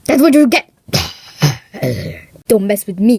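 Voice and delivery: loud gloating villain voice; menacing villain. Acted. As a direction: threaten the vlogger like a movie villain. Lights out, menacing voice.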